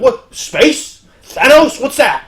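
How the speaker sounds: high, loud baffled shouts; hammed-up bewildered yells; confused yelling. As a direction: villains yelling in total confusion. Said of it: "they're all just yelling".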